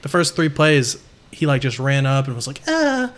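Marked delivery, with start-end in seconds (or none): 2.7-3.1 high-pitched mock "ah"; imitated feeble cry. Imitates Clay running into a tackle.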